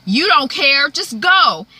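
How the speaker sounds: loud, high accusation; acted anger. Melissa rejects Jeffrey at her bedside, acted anger. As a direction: send them away with hurt anger. Acted quarrel at hospital bed.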